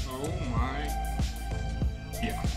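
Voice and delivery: ordinary talk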